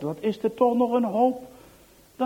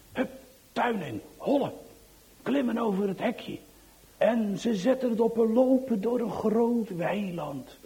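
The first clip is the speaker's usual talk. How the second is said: ordinary delivery, nothing marked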